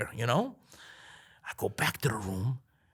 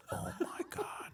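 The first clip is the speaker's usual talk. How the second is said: normal talking voice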